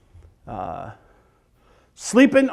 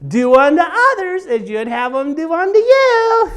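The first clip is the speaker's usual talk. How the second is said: higher, slow sing-song reminders; preachy GPS voice; 6 semitones higher than usual. Retold or imitated. Wife in passenger seat reminds him, prim sing-song. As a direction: recite gently like a nagging GPS lady.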